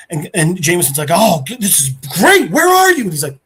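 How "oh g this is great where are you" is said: loud, high-leaping eagerness; imitated excitement. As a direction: act out an editor thrilled by a scoop. Jameson reacts to the scoop.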